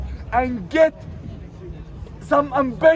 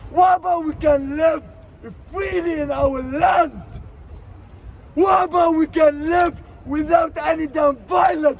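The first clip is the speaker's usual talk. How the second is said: raised, higher angry shouting at the government; 5 semitones higher than usual. Real, not acted. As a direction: yell out the frustration, pitch up.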